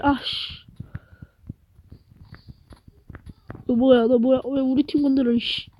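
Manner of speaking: quiet annoyed muttered curses; cursing under breath. Real, not acted. Gamer annoyed at enemy attack.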